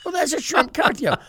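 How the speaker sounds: high, loud hammed-up outrage through laughter; mock scolding. Preachers ganging up on the young preacher, mock indignation. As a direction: scold him in jest, barely holding the laugh.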